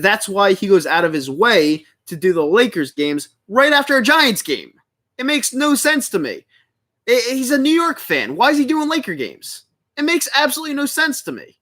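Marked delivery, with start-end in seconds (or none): 3.5-11.6 high-pitched indignant ranting; heated. Real, not acted. Streamer baffled by a rival's choice of games, heated. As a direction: argue it in real exasperation, voice riding high. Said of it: "I'm getting so heated over this".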